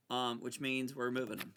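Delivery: ordinary talk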